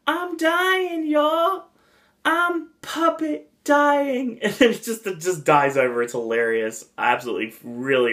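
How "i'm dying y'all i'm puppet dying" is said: very high-pitched southern puppet voice; mock dying wail with a southern twang; squeaky southern dragon, hamming up his death; exaggerated southern accent. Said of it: "uh, southern accent".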